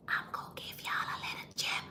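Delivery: hushed, secretive whisper. Real, not acted. Host giving tour followers a secret gem, whispered. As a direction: whisper a tip nobody else should hear. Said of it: "I can't say it too loud".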